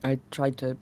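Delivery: soft, halting, stammering wounded voice; hurt winged character. Acted. Injured winged friend reunited and comforting, soft halting stammer. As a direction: stammer softly through the pain. Emotional roleplay scene between characters.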